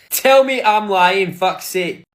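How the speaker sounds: loud challenge. Real, not acted. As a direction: shout it slow, daring them to disagree.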